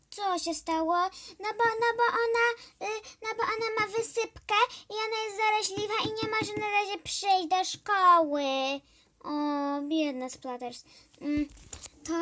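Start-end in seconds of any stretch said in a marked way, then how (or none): 1.4-8.4 rushed excuse. Acted.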